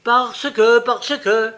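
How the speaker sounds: loud, high, exasperated snap; wrathful retort. A narrator's reading. Angry fairy turns her back on the shopkeeper, wrathful. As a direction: shut the questioner down angrily, high and loud. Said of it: "répliqua la fée courroucée".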